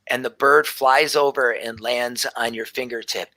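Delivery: ordinary talk, nothing marked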